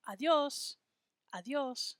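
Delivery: ADIOS as ah-dyohs, di gliding into os; two-syllable adios. Shown as a demonstration. Teacher models it for the class, adios in two syllables. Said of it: "just two syllables".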